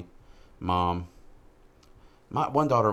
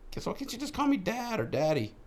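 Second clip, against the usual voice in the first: much higher, wounded pleading; hurt dad. Retold or imitated. Father asking daughter to call him dad, much higher. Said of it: "I was like".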